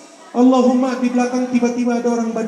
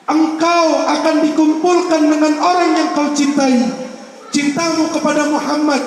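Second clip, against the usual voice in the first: higher, ringing sermon cadence; fervent preaching; 4 semitones higher than usual. Acted. Preacher exhorts the gathering, raised and fervent. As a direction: preach it out to the congregation.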